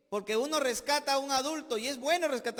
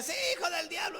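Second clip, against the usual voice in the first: mock yell; 7 semitones higher, faster than usual. Pastor mocking his own shouting from the pulpit, higher. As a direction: imitate the pastor's fiery cry. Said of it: "tanto que anda gritando".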